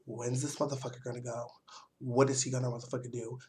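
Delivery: nervous muttering to herself, rushing; scared inner thoughts. Retold or imitated. Scared thoughts about the man following her, hushed and quick. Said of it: "I'm a little bit scared".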